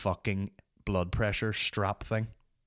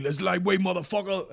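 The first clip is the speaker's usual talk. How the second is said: very high hammed-up bodybuilder cry; Ronnie Coleman impression; 9 semitones higher than usual. Imitating Ronnie Coleman running around the gym, high hammed-up cry. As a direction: belt it like a strongman lifting. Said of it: "he should be like".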